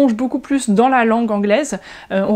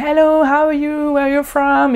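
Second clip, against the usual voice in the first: higher, drawn-out cheery hello; friendly American greeting; 4 semitones higher than usual. Retold or imitated. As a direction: greet a stranger openly in sing-song English. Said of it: "ils sont très ouverts".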